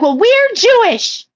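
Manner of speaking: put-on high, slow, smug little-girl voice; mocking girls' reply. Mocking the girls at the playground in a high, slow bratty voice. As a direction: squeak it out like a bratty kid.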